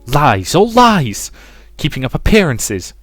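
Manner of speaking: high, loud, indignant cry; furious outburst. A narrator's reading. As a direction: explode in scornful denial. Mother's voice rages at Rosa.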